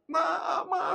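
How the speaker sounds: high mock wail for mother; crying call. Lost child starting to cry for mother, imitated wail. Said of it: "Saya mulai saya mulai menangis".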